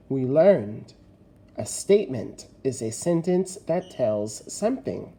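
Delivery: ordinary talk, nothing marked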